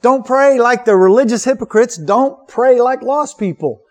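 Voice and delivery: a firm correction, rushing at first; emphatic Jesus quote. Retold or imitated. Preacher voicing Jesus correcting casual prayer, insistent. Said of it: "And Jesus says".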